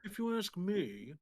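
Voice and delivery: slow, clenched-teeth British voice; through gritted teeth. Shown as a demonstration. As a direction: grit your teeth and drawl it. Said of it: "you try talking through your teeth".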